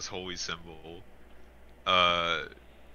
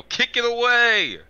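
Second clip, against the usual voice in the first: loud, high, drawn-out shout; in-character yell; 9 dB louder, slower than usual. Acted. Blade's player warning about the bomb, in-character yell. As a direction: yell an urgent order across the field. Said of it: "Blade's gonna yell".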